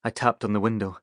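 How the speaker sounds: ordinary delivery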